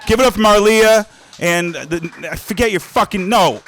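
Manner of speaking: loud hype-up call; announcing loudly. Real, not acted. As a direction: call it out to the room. Calling out to the room.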